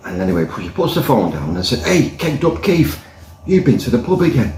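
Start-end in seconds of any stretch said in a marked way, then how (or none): 1.8-4.5 hint of a nagging wife catching him out; mock scolding wife. Wife tells Kegged Up Keith off, lightly voiced nag.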